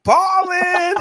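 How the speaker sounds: high, loud mock cheer; joking shout. Calls out punchline amid shared laughter.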